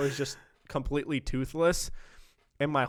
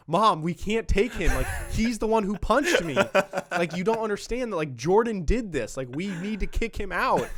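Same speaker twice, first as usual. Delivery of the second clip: urgent plea; 6 semitones higher, 3 dB louder than usual. Retold or imitated. Pleading with mom in dream.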